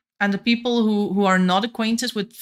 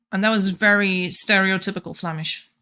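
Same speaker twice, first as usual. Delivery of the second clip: ordinary talk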